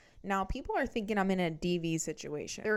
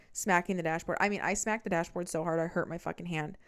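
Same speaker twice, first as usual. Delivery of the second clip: ordinary talk, nothing marked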